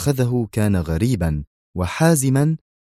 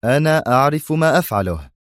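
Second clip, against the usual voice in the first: firm, raised-pitch retort; stern; 6 semitones higher than usual. A narrator's reading. Brigadier answering his worried colleague, stern. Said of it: "قائلا في صرامة".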